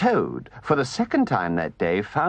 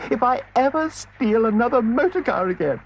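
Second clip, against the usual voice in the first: high, loud panicked vow; repentant Toad; 11 semitones higher, 3 dB louder than usual. Acted. Toad drowning in the river vows never again, panicked. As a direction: swear it off in desperation.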